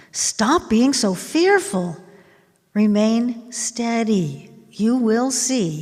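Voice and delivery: steady a frightened crowd with slow, firm orders; firm command. Acted. Reads Moshe's command to frightened people.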